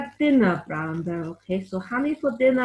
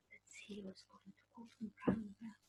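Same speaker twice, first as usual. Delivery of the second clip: ordinary delivery, nothing marked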